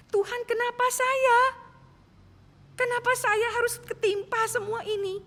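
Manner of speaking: high, pleading cry to God; anguished prayer. Retold or imitated. Woman pleading with God over her stricken father, anguished. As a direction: beg God for an answer, voice straining high.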